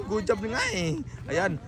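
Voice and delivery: normal talking voice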